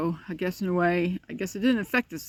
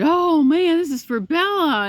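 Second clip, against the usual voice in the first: much higher and louder, thrilled; excited announcing; 9 semitones higher, 8 dB louder than usual. Retold or imitated. Owner telling her puppy a parcel came, in a thrilled high loud voice. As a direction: sell the surprise to the dog.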